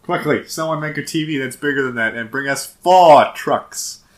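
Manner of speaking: loud, slow, urgent order; urgent command. Pretend. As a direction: bark the order like a captain.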